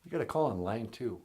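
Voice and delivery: soft put-on assistant voice. Second character voice.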